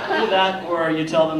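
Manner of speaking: usual speaking voice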